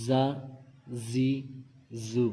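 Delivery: زا، زی، زو with a strong buzzing z; hard whistling za. Shown as a demonstration. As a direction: whistle the z of زا، زی، زو through the teeth. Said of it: "سیٹی کی آواز نکالیں گے".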